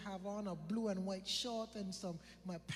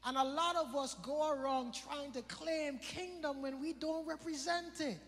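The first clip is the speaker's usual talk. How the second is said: rising, louder preaching cadence; 6 semitones higher, 3 dB louder than usual. Acted. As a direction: drive the point home from the pulpit. Preacher building to a climax.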